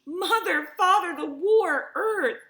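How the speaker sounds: high, loud panicked cries of a frightened daughter; sobbing girl. Acted. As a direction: cry out for your parents in alarm. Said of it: "she sobbed".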